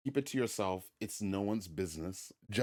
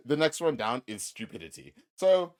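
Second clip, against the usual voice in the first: normal talking voice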